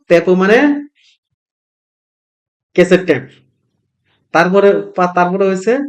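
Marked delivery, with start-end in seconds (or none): none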